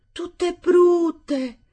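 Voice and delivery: "Tutte brutte?" cried out high and loud; the bride can't believe her ears; shocked disbelief. Acted. Bride echoes husband's words, high and loud in disbelief.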